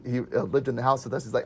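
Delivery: ordinary delivery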